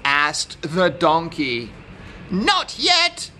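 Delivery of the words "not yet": high-pitched rooster's refusal; rooster character. A narrator's reading. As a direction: answer as a rooster, pitched well up. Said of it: "said the rooster".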